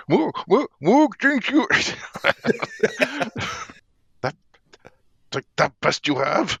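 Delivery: high, loud laughing brute taunt; cocky orc jeering through laughter. Acted.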